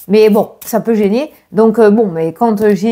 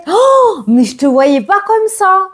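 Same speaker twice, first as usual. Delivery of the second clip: high outraged oh; teasing fake indignation; mock shocked; 10 semitones higher, slower than usual. Real, not acted. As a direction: play hurt surprise, up high.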